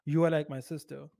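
dead-serious, each word said in full. Shown as a demonstration. Man telling a date she is like his sister, serious. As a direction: say it earnestly, no joking tone. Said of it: "would be taken seriously".

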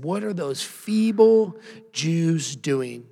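slow, sneering bully taunt; a preacher voicing a slow, jeering bully; mocking bully. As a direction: drag it out like a schoolyard bully. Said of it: "this is what a bully sounds like right here".

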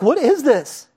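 high startled cry of alarm; mock panic. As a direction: sound spooked by a ghost. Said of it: "they're freaking out".

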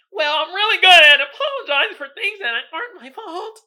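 high, bright put-on woman's voice; sweet customer service voice. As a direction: play the chirpy job applicant.

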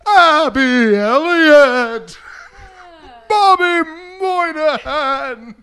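high, slow lineup announcement; mock announcer calling out ex-lovers' names. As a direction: stretch each name like a show host. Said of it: "with that voice".

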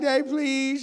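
put-on high pleading child voice; imitated little girl begging; pleading daughter. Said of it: "she's just like".

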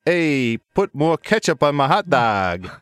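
slow, hammed-up Chicago accent; drawn-out Chicago vowels on "hot dog". Shown as a demonstration. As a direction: stretch out a mock Chicago catchphrase. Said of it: "very famous Chicago phrase".

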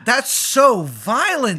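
high, loud, drawn-out protest; slow, high-pitched reaction to gore; appalled disbelief. Real, not acted. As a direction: exclaim it in scandalized shock. Appalled by character's death.

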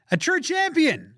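high, slow mock-rousing call; exclamation-mark reading. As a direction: proclaim it high and drawn out. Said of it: "Exclamation point".

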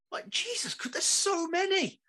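high exasperated whine. Real, not acted. Fan complaining to a friend about endless subplots, exasperated high whine.

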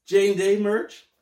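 loud, high, drawn-out plug to camera; hammed-up merch shout-out; promo plug. Shown as a demonstration. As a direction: announce it big and slow for the camera. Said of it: "look into that camera, that camera and say".

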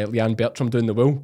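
usual speaking voice

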